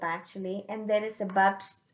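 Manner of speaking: ordinary delivery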